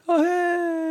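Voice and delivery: high, airy sing-song mockery; carefree hikers. Hikers mimicking people skipping past in flip-flops, high and breezy.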